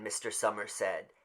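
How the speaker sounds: ordinary delivery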